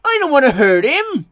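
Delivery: loud, high-pitched protest; upset engine, high and loud; dismayed wail. A narrator's reading. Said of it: "he wailed".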